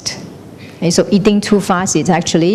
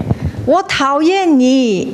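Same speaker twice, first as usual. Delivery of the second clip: high, slow calling out; cliff-top shout; 6 semitones higher, slower than usual. Acted. Someone shouting dislike from the same cliff, high call. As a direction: holler it slowly from a cliff. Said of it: "you shout".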